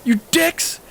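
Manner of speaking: high, whiny bratty scream; high whining yell at his friends. Retold or imitated. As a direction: scream it like a spoiled kid. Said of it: "he screams at us".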